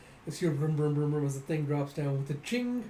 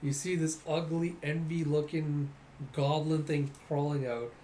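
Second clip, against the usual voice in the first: ordinary talk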